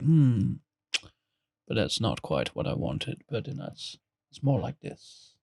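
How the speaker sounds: halting, pause-filled thinking aloud, fading to whisper; Jeff Goldblum. Acted. Imitating how the actor takes time to think, hushed halting impression. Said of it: "and then he's like".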